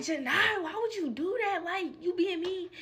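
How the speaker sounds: lightly voiced little sister, high and pleading; a hint of a younger sister scolding; a little sister asks why she was so mean; sister's voice. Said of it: "my sister was like".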